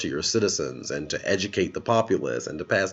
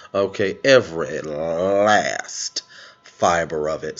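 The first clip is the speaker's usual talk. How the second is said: savouring, drawn out; 4 semitones lower, slower than usual. Real, not acted. Savouring the headlines.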